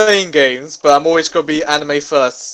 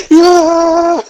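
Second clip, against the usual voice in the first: mock cinema crowd yelling yeah; crowd cheer; 14 semitones higher, 7 dB louder than usual. Friend recalls the cinema crowd, high excited yell. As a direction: shout it like a cheering audience.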